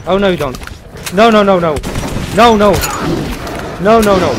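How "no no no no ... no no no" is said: frantic high loud no's; panicked. Real, not acted. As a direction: panic as it closes in. Panics as enemy attacks.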